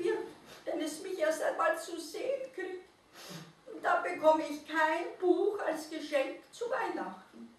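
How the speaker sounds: a tiny animal feeling ugly and hopeless; sad little mole-rat. A narrator's reading. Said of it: "seufzte der Nacktmull".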